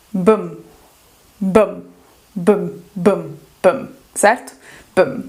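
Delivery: low, short "bam" with no real vowel; B-M blend. Shown as a demonstration. Teacher showing learners B and M run together, short blended sound. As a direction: say B straight into M as "bam". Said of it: "tenta falar esse B e M".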